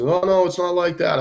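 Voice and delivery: high mocking voice of a backpedaling boss; mock supervisor. Dad mocks the supervisor denying it, high mocking voice. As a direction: pipe it up high like a flustered boss.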